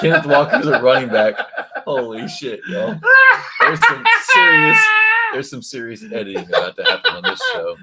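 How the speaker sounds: laughing in disbelief. Real, not acted. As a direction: crack up while talking. Laughs at host's mixup.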